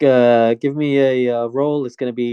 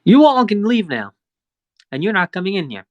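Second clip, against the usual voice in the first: raised-pitch guard barring the way; stern guard; 6 semitones higher than usual. Acted. Guard turns the group away, raised and firm. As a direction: shut them out, firm. GM voices guard refusing entry.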